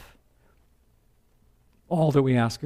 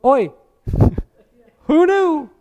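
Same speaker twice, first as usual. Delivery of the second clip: high, loud astonished exclamation; surprised Naomi; 7 dB louder, slower than usual. Retold or imitated. Mother-in-law gasps at the forty pounds of grain, high and astonished. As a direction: squeal it out in amazement. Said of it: "And Naomi goes".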